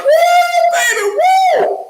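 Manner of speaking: very high, drawn-out whoops; whooping with self-congratulation, slow and loud; hyped woo. Retold or imitated. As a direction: cheer yourself on like you've already won. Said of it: "And I'm like".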